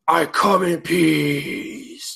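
slow, drawn-out dying alien. Retold or imitated. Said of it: "and the alien goes".

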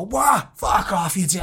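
mock outraged shout. Mates in the boozer shouting down his opinion, mock outrage. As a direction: play the pub lads shouting him down, slowly. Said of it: "And everyone would go".

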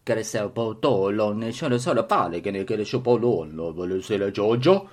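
mock Italian gibberish; fake Italian. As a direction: pretend Italian accent. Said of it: "Now we can speak Italian".

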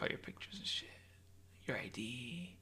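very soft, whispery murmur; acted secretive whisper; conspiratorial hush. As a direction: list it under the breath like a secret. Acting Elon's secret knowledge.

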